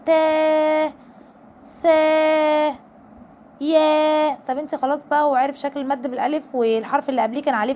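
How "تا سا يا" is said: تا سا يا each with the alif held long; drawn-out تا، سا، يا. Shown as a demonstration. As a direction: stretch each syllable out. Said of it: "لما يبقى فيها المد بالألف".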